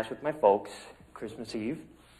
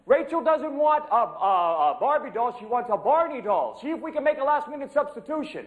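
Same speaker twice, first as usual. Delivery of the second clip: Santa yelling orders; 12 semitones higher than usual. Pretend. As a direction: bellow it up high across the workshop. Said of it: "I yell orders to the elves".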